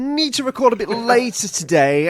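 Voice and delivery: high, drawn-out mock pleading; whiny imitation. Co-host begging to record later, whiny imitation.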